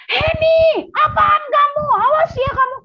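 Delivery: high, loud angry threat; threatening yell. Pretend. A stranger suddenly shouts threats at Heni. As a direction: snap a warning at Heni.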